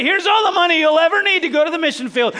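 loud, high hammed-up announcement; mock proclamation. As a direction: call it out like an exaggerated offer from on high. Imagined God handing over mission money.

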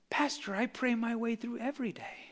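put-on old man beaming as he speaks, softly; smiling old man. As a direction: say it gently with a smile. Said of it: "he smiles and he goes".

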